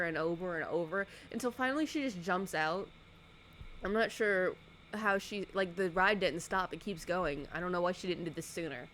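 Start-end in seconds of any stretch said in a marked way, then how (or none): none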